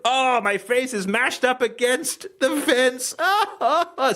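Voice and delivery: put-on high, slow whining child; squashed child wailing. Host playing a bullied child, high slow wail. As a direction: wail like a kid pushed into the fence. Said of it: "the mind of a child".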